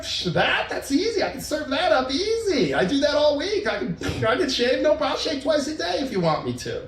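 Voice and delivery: high, breezy can-do boasting; eager bragging. Retold or imitated. Boyfriend responding to praise, eagerly willing.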